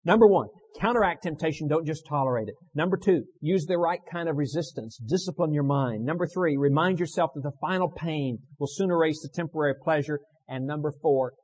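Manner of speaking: loud, emphatic pulpit listing; forceful preaching. Real, not acted. Preacher recapping steps against temptation to the congregation, forceful preaching. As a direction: hammer out each numbered point.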